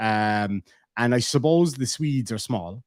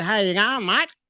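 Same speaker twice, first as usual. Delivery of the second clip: put-on Australian accent; 6 semitones higher than usual. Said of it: "[name] says".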